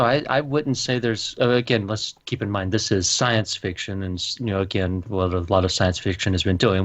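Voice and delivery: ordinary delivery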